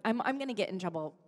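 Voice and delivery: ordinary talk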